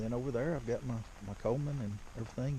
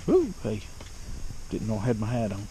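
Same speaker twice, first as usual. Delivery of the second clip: surprised; 10 dB louder than usual. Real, not acted. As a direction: caught off guard by the hat. Bumping into his hat.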